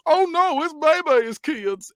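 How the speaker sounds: high-pitched mock panic; hammed-up cry of dread; officer's alarmed yell. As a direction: yell it high, imitating a horrified cop. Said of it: "And the officer yells".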